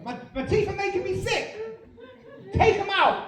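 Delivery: very high frantic shouting; hammed-up delirious hysteria; delirious demand. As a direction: plead hysterically at the top of your range. Delirious patient demands teeth out.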